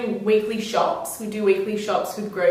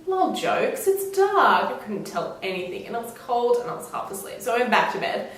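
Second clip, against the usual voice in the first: usual speaking voice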